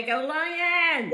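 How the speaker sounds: rally the fans; cheering. Real, not acted. Cheering for the team.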